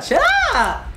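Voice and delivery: very high shriek cutting off the words; startled shriek. Real, not acted. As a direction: break off with a sudden scream. Startled mid-sentence by a scare.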